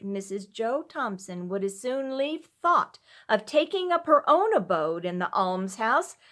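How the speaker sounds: ordinary delivery, nothing marked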